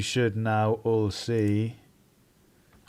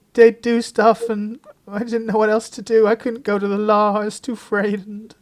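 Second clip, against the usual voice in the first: high, louder pleading, voice near breaking; pleading in tears; 10 semitones higher, 4 dB louder than usual. Acted. Celia breaking down in front of the inspector, high tearful pleading. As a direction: beg through mock tears. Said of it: "breaks down and starts crying".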